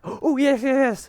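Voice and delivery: very high, gushing yes; thrilled squeal of delight; excited. Retold or imitated. Teacher thinks she won millions.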